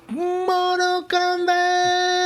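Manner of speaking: very high, slow drawn-out call; Mortal Kombat announcer. Retold or imitated. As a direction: stretch it like an arcade announcer. Game announcer imitation.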